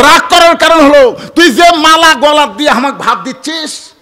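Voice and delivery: loud, high-pitched marital outburst; heated, accusing retort; angry husband. Retold or imitated. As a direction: act out a husband scolding his wife at full volume. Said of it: "স্বামী রাগ করে বলতেছে".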